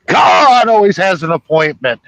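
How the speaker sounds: hammed-up gym teacher yelling; mock yell. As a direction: boom it out so the cards fall. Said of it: "from her yelling".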